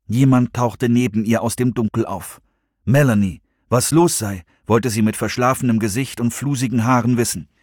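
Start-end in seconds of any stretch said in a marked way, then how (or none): none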